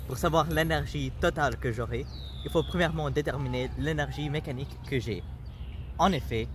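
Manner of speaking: ordinary talk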